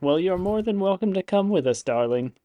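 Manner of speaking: a lightly voiced wry old grandmother; gentle, amused granny invitation; wry grandmotherly. Wendy inviting the player along, a hint of grandmother. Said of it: "wry, like, grandmotherly smile".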